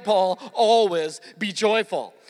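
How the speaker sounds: slow, mocking "Paul, always be joyful"; mock easy advice. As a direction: drag it out as if it were that easy. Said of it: "Pretty easy to write".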